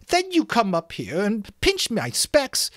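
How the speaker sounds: put-on high, loud whiny boy; Piggy voice.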